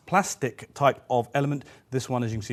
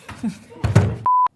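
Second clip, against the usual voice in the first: high giggle; 7 dB louder than usual. Real, not acted. Outtake giggling fit.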